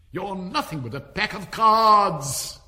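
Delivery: high, loud, slow denunciation; defiant shout. A narrator's reading. Alice denouncing the whole pack of cards, defiant shout. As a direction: denounce them all at full voice.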